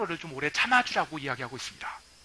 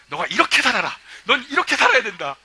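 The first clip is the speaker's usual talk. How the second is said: louder, laughing mock lecture; mock stern advice; 4 semitones higher, 8 dB louder than usual. Imitating Pastor Song telling people how to live, hammed-up louder advice.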